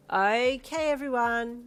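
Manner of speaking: loud call to a group. Acted. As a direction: raise your voice over the kids. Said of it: "you called".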